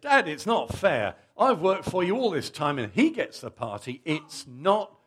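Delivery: whiny complaint, fair rising to a loud wail; sulky son. Acted. Older son complains to his dad, whining. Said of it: "The son said".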